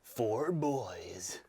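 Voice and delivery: breathy whispered trailer voice; hushed, dramatic whisper of a movie trailer; trailer voiceover whisper. Pretend. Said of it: "Big American voiceover".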